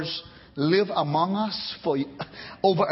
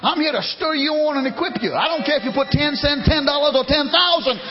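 usual speaking voice

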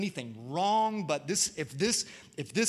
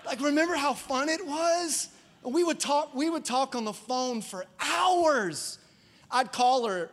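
higher and louder, lit up by the memory; excited remembering; 7 semitones higher, 4 dB louder, slower than usual. Real, not acted. Preacher recalling courtship phone calls, excited. Reminiscing about dating with excitement.

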